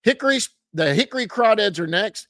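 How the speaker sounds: ordinary delivery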